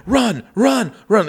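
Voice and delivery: high urgent yell of run; mock shouted run. Mum yelling at her kid to flee, high urgent shout. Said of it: "she's like".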